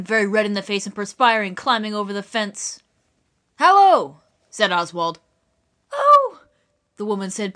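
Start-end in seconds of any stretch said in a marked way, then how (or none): 3.6-4.2 loud shouted hello; calling out. Acted. Boy calls out at fire. 5.9-6.4 high startled gasp of oh. Acted. Woman startled, panting.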